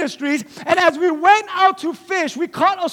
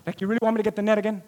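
fast, disbelieving question; incredulous rush; 6 semitones lower, 7 dB quieter, much faster than usual. Acted. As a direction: rattle it off in disbelief. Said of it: "Peter's like".

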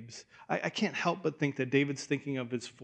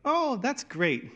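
high, slow, fake-cheery reply; mock enthusiasm; 9 semitones higher, slower than usual. Dad brushing off his toddler's babble, mock cheerful. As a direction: humor the babbling kid with a bright brush-off. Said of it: "I'm like".

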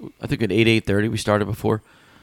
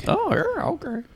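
squeaky high; 8 dB quieter than usual. Acted. Squeaky reaction.

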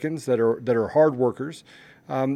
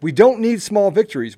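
ordinary talk

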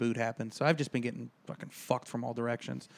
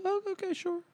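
squeaky pushover voice, much higher; meek high voice; 4 dB quieter than usual. Retold or imitated. Caving to the mechanic in a small, squeaky high voice. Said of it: "being a little bitch I am".

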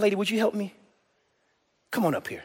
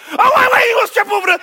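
high, loud sudden warning; mock alarm; 12 semitones higher, 4 dB louder, faster than usual. Preacher faking alarm at blindfolded Kelsey, high and loud. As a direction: cry out as if she'll trip. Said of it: "And I'm".

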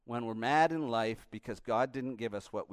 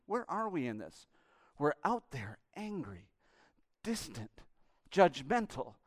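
ordinary talk, nothing marked

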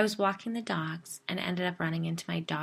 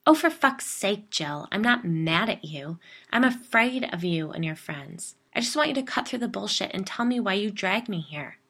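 louder, fed-up and frightened; exasperated outburst; 3 semitones higher, 8 dB louder than usual. Acted. Narrator exploding at Jill in frustration, loud. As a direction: vent your frustration hard.

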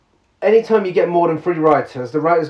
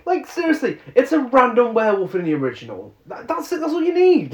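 exasperated; 8 semitones higher than usual. Real, not acted. Exasperated rant about werewolf society.

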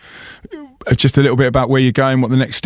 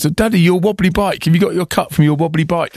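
put-on higher-pitched little-kid voice; put-on child's voice, raised in pitch; imitating young son; 5 semitones higher than usual. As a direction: quote your small child lightly teasing you. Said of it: "my Jack still says".

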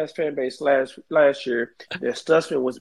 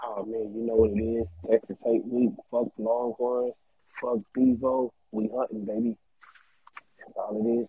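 muffled, faint and far off; like calling up from a well; trapped in a well; 3 semitones lower, 10 dB quieter than usual. Real, not acted. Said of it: "Why does it sound like you're trapped in a well?".

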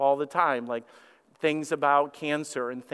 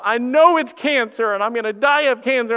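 mock panic; 7 semitones higher, 7 dB louder than usual. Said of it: "our minds go crazy".

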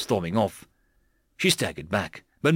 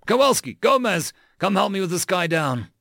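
raised, higher-pitched sergeant's call; calling out orders; 7 semitones higher than usual. Acted. Sergeant calls Kowalski and Gomez to help, calling out. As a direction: summon two squadmates over.

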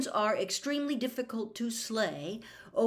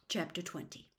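ordinary delivery

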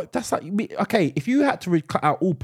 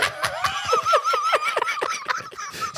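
high squealing laugh; 4 dB quieter than usual. Real, not acted. Laughing fit at own joke.

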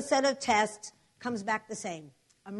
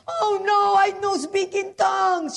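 put-on loud, high, distraught Spanish-accented voice; 10 semitones higher, 7 dB louder, slower than usual. Maria dismayed about tongues, imitated Spanish-accented wail. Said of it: "She was Spanish".

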